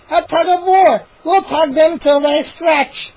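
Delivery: high, slow, loud gnome shout; gleeful gnome shouting. A narrator's reading. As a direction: gloat loudly. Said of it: "Chip shouted".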